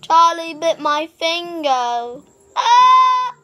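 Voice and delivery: put-on loud, slow toddler wail, rising to a high yelp; Charlie bit my finger. Brother acts the toddler's complaint, then a loud yelp.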